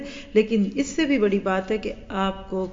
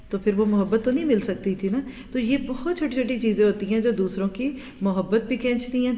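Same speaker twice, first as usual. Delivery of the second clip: ordinary delivery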